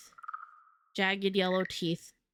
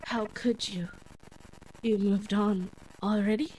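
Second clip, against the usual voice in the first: hurt ghostly accusation. Acted.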